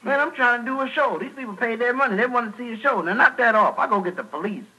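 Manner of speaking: annoyed performer, pitched high; David. Acted. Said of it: "David said".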